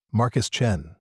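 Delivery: usual speaking voice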